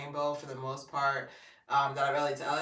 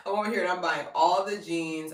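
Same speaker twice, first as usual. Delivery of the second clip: ordinary talk, nothing marked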